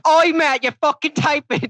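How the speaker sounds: high, loud fake English yell; mock English accent. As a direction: bark it at Matt like a cartoon Brit. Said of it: "I just yell in English accents at Matt".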